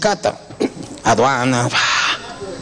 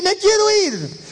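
demon fading; 11 semitones higher than usual. Retold or imitated. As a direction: let the plea die away. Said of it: "El diablo quería escaparse".

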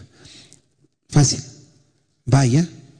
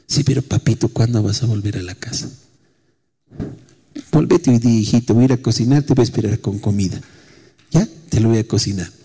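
tender coaxing; 4 semitones lower than usual. Retold or imitated. Mother coaxing son tenderly.

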